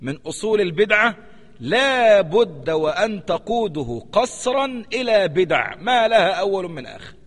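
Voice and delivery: slow, weighty reading of Ibn al-Qayyim's words; measured scholarly citation; slow quotation. Shown as a demonstration. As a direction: recite the quote slowly and gravely. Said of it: "وقال ابن القيم".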